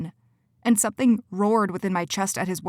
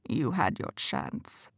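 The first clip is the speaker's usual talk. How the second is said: slow, much lower and softer; low menace; 5 semitones lower, 7 dB quieter, slower than usual. Acted. Quentin passes judgement on Cora, low and slow. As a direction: pronounce the verdict quietly. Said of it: "Quentin said".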